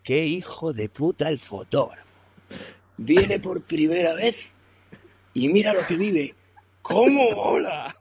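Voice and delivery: high, delighted, half-laughing thrill; mock awestruck delight, drawn out at the end; thrilled guide. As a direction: imitate a guide loving the danger.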